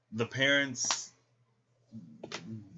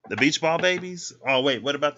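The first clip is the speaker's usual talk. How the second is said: sudden recall; 4 semitones higher, 9 dB louder than usual. Real, not acted. Suddenly remembers a dream.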